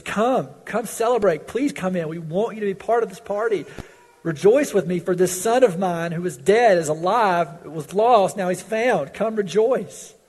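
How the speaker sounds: loud, high, urgent pleading; a father calling his son to the feast. Acted. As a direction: beg him warmly to come in.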